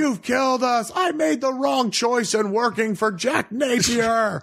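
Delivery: high shouted mock complaint; hammed-up, high, slow lament; mock dying henchman. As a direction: wail at Batman as a doomed worker. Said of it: "people are like".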